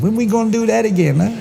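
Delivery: put-on very high French-accented girl voice. French girl to her lover after lovemaking, high French accent. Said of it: "The French girl says".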